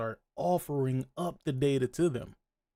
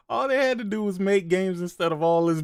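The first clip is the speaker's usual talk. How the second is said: usual speaking voice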